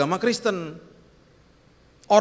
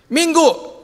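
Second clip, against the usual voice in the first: higher, louder hopeful call; pleading ask; 9 semitones higher, 6 dB louder than usual. Retold or imitated. Friend asking the churchgoer out for coffee, higher and louder. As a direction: push the question up, almost begging.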